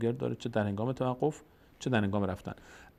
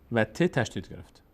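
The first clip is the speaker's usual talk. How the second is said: ordinary talk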